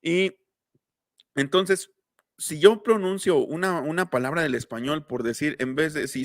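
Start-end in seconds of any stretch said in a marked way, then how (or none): none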